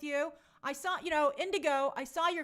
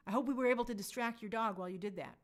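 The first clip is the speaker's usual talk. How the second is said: normal talking voice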